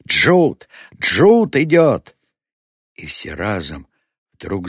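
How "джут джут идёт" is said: high, loud cry of alarm; wild scream. A narrator's reading. Tribesman spots the dreaded face and alerts all, wild scream. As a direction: scream a warning with the last word drawn out. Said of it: "дико закричал".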